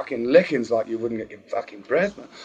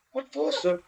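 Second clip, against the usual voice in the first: high, softer schoolboy voice; innocent schoolboy; 11 semitones higher, 9 dB quieter than usual. Acted. Caught boy answering his teacher, innocent schoolboy. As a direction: plead innocence, high and small.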